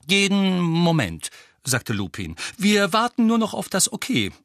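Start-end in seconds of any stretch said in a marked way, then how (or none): 0.1-1.3 high, loud, drawn-out answer; Lupin's voice. A narrator's reading. Lupin telling Harry they leave soon, raised drawn-out voice. 2.6-4.3 high, forceful character voice; Lupin's voice. A narrator's reading. Lupin telling Harry what they await, high voice.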